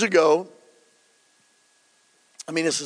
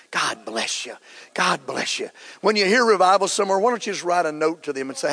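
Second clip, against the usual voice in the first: ordinary talk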